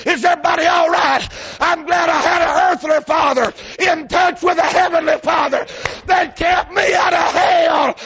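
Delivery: high, shouted preaching climax; full force preaching. Acted. Preacher telling the church how his father saved him, shouting. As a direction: shout the testimony to the rafters.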